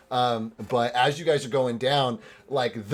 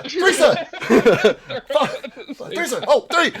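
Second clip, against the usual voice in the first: excited shout; 13 semitones higher, 7 dB louder, slower than usual. Real, not acted. GM calls out at roll.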